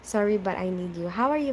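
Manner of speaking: usual speaking voice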